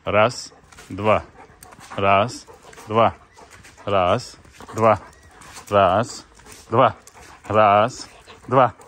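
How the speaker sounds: loud commands. Real, not acted. Commands while training the dog.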